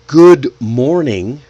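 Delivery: "good morning" slow, both words full; unlinked. Shown as a demonstration. Teacher shows full form before linking, slow and separate. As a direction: say "good morning" word by word, carefully. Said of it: "You can say".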